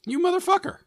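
high squeal of mock outrage; outraged squeal. Real, not acted. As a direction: squeal it in fake betrayal. Mock outrage at being teased.

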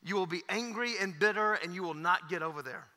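ordinary talk, nothing marked

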